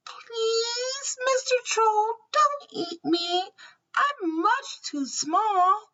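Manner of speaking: high, pleading tiny voice; afraid little goat. A narrator's reading. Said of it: "Little Billy Goat Gruff was afraid".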